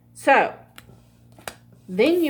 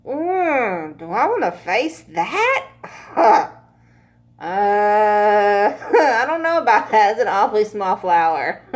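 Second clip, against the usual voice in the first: laughing hesitation; 4 dB louder than usual. Pretend. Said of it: "It's like".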